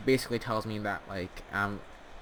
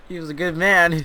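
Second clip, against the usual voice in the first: mock eulogy; 6 semitones higher, 8 dB louder than usual.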